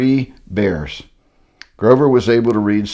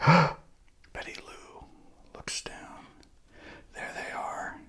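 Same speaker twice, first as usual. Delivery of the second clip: hushed breathy whisper; 7 semitones higher, 17 dB quieter, slower than usual. A narrator's reading. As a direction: lean in and whisper it. Narrator hushes at the twist.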